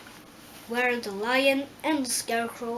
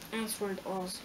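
usual speaking voice

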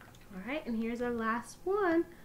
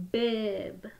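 ordinary talk

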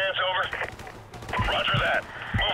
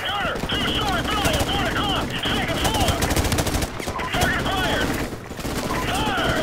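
shouted fire orders; 12 semitones higher than usual. Acted. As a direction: call the target over gunfire. Gunner fire orders in combat.

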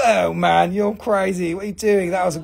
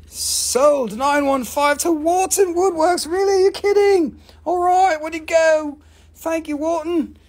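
excited; 10 semitones higher, 4 dB louder than usual. Real, not acted. Thrilled at a sale.

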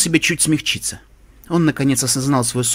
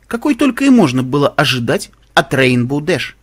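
ordinary delivery

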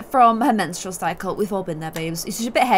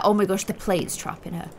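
ordinary talk, nothing marked

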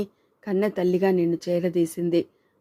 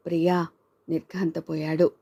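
ordinary delivery

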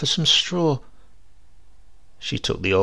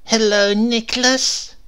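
high, loud call, the name drawn out slowly; old woman calling to the carpenter, loud and drawn out; 11 semitones higher, 5 dB louder, slower than usual. Acted. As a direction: call his name across the shop. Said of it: "opened the door and called".

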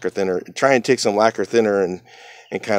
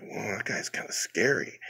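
much softer, slow, under the breath; scared aside; 12 dB quieter than usual. Retold or imitated. Looking at the scary coworker, hushed and slow. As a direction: keep it to yourself, slightly spooked.

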